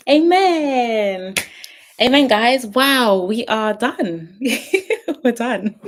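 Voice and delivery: high, loud whoop of relief; jubilant, stretched-out cheer; elated celebration. Real, not acted. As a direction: celebrate the finish, drawing words out and breaking into a laugh. Celebrating end of series after prayer.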